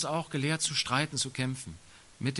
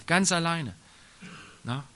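ordinary talk

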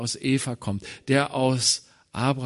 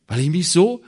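anguished outburst; 8 semitones higher, 7 dB louder than usual. Acted. Preacher voicing a discouraged believer, anguished loud outburst. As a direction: voice climbs high and loud in despair.

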